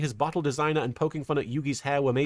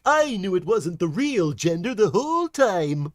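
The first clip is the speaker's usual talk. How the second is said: Tristan voice; 3 semitones higher, 4 dB louder than usual. Retold or imitated. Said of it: "Tristan would be like".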